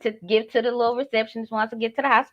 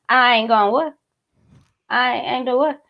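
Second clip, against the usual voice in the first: normal talking voice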